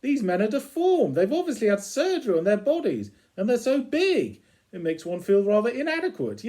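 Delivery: mock outrage at the magazine; hammed-up prim disgust; prissy Rimmer voice. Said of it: "He goes".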